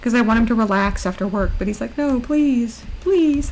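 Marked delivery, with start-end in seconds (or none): 2.0-3.5 higher-pitched imitation of begging; imitated pleading. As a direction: plead as the eager helper. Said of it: "That's what he says".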